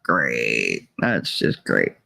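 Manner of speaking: very low, dry sarcasm; sarcastic resignation. Retold or imitated. Mother answering her smartass son, sarcastically resigned. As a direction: drop the voice right down, drily unimpressed.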